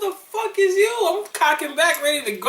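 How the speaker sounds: high, fast, laughing challenge; mock confrontation. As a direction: confront an intruder in comic outrage. Acts confronting an intruder.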